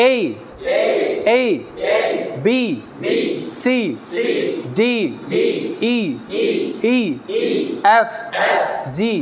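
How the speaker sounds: teacher says each letter, class echoes; call and repeat. Shown as a demonstration. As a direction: drill the alphabet aloud, letter by letter. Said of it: "আমার সাথে সাথে বলবা". (শিক্ষক বলেন, ছাত্ররা পুনরাবৃত্তি করে)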